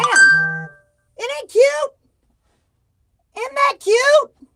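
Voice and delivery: high, drawn-out cooing; gushing cute voice. Real, not acted. Showing off the blanket.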